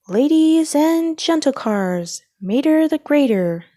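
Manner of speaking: announcer introducing the daredevil. A narrator's reading. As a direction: boom it across the stadium. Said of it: "screamed the announcer".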